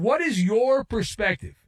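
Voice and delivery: ordinary talk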